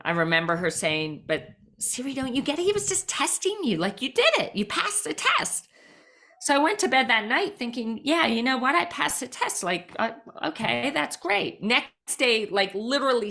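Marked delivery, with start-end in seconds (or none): 1.3-5.7 high-pitched, encouraging mother voice; lifted, insistent reassurance; reassuring mom. Retold or imitated. Voicing her mother reassuring her. 8.0-11.6 imitated hopeful self-talk, pitched high; lifted, mildly pleased inner voice; self-reassuring. Retold thoughts, convincing herself she passed.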